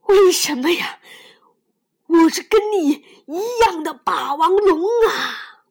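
loud, high, breathless protest; wounded T-rex crying out; panting cry. Acted. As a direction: gasp it out, hurt. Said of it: "霸王龙喘着粗气说".

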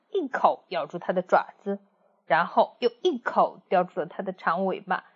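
ordinary delivery, nothing marked

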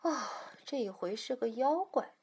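soft sighing exclamation; weary sigh. Acted. Mother hears of a monster, sighing. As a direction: sigh out, then speak softly. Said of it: "妈妈也长叹了一口气".

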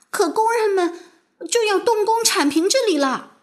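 high-pitched, worried protest; anxious. A narrator's reading. Girl warns that workers will flatten the field, anxious. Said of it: "麦吉娜着急地说".

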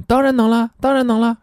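put-on very high, gleeful child voice; bubbling over with childish eagerness; excited child. Shown as a demonstration. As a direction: squeal it like a thrilled kid. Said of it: "小孩子很兴奋".

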